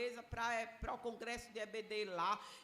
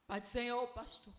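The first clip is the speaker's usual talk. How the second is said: lightly put-on composed voice; composed phone greeting. Churchgoer answering her pastor's call after crying, lightly composed. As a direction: imitate yourself greeting politely with a tidied-up voice. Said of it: "ajeitei a minha voz".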